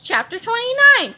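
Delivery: plain reading voice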